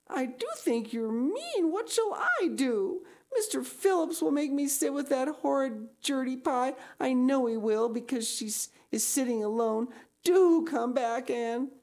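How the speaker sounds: high, tearful, pleading voice; crying. Acted. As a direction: sob out the words in distress. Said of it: "looked as if she were ready to cry".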